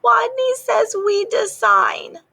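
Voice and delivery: high, timid squeaky mouse voice; tiny squeak. Acted. Timid little rat squeaking to the eager players, high and small. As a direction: squeak it out, scared to speak. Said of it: "and squeaked".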